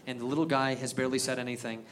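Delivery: usual speaking voice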